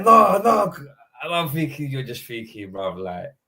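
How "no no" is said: higher, louder anguished "No, no"; despairing no. Retold or imitated. Reacting to the late penalty, loud anguished cry. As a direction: cry out as the penalty goes against you.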